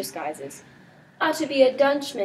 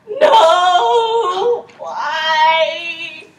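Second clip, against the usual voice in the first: much higher, much louder anguished shout; despairing cry; 6 semitones higher, 9 dB louder, much slower than usual. Acted. Crying out for Hero in despair, anguished shout. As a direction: cry out her name in agony.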